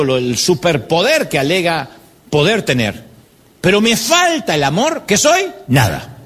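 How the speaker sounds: scripture reading. Shown as a demonstration. (lectura bíblica lenta y solemne)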